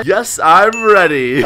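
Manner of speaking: loud, drawn-out eager yes; eager reply. Pretend. Child criminal answers the parent's ready check, eager. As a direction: answer loudly, stretching each word.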